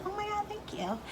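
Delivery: a touch of hammed-up gratitude; gushing thank-you. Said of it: "And I was like".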